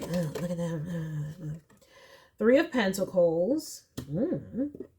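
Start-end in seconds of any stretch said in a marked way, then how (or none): 0.0-1.6 petty sneer. Retold or imitated. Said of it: "they're like".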